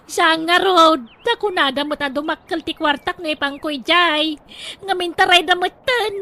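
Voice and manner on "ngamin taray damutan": tearful lament. Acted. Wife laments the lost money.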